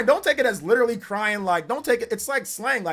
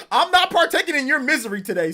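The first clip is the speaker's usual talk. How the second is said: laughing through the words, a bit louder; 6 semitones higher, 5 dB louder than usual. Real, not acted. Streamer laughing at complaining viewers, gleeful and louder. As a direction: chuckle it out, gleeful at their misery.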